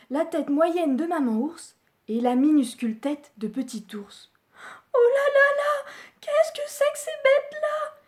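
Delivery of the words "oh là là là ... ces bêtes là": much higher, louder sob of terror; a panicked little girl crying out; sobbing in fright. Acted. Goldilocks waking to three bears, high frightened sob. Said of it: "dit-elle d'un sanglot".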